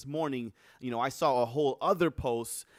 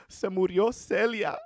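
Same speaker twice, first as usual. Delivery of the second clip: mother grieving; 7 semitones higher, 3 dB louder than usual. Retold or imitated. Mother voiced, grieving [name].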